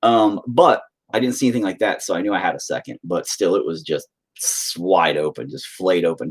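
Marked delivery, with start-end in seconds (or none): none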